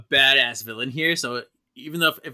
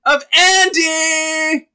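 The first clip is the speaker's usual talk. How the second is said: big dramatic reveal; 13 semitones higher, 12 dB louder than usual. Acted. Reading the spirit's reveal to her co-host, loud and thrilled.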